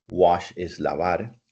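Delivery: ordinary delivery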